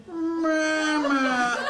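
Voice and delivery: high, mechanical toy-doll "Mama"; sing-song talking-doll "Mama", high and flat; doll voice box. Retold or imitated. Broken baby doll voice box calls out, high and mechanical. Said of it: "the baby said".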